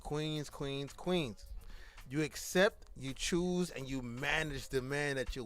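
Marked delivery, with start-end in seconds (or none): 0.0-1.5 quiet, understated call to queens; low-key address. Real, not acted. Host counsels the women listening, low-key and soft. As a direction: softly address the queens, dropping away. First take, repeated for emphasis.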